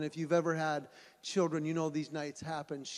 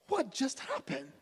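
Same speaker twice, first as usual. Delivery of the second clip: higher, amazed question; stunned disbelief; 8 semitones higher than usual. Retold or imitated. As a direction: ask it in total astonishment. Stunned parents.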